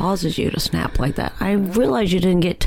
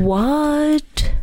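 shocked; 8 semitones higher than usual. Real, not acted. Hearing of an earlier killing.

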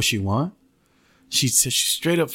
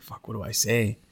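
awkward aside; 2 semitones lower, 6 dB quieter than usual. Retold or imitated. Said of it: "I started getting awkward".

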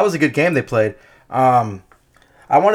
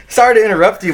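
normal talking voice

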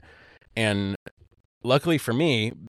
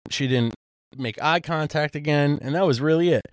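ordinary delivery

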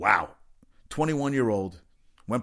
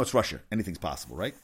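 ordinary talk, nothing marked

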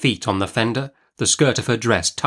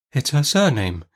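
ordinary delivery

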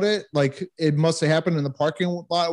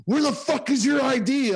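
imitating a furious military cop, pitched much higher; mock MP demand; 10 semitones higher than usual. Retelling how the MPs demanded his ID, mock yell. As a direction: throw the demand like an angry MP. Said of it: "they're like".

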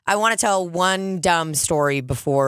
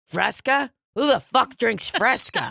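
put-on incredulous old man; 8 semitones higher than usual. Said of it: "looks at me, he's like".

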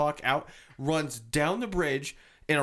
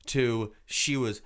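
plain reading voice

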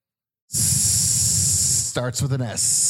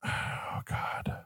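breathless whisper of defeat; 11 dB quieter than usual. Real, not acted. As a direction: whisper it under your breath. Stumped by a trivia question.

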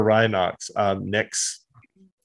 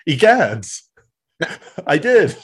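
lightly higher hammed-up surprise with a laugh; mock startled gasp; 6 semitones higher, 4 dB louder than usual. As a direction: caught off guard, laughing through it. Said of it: "And he's like".

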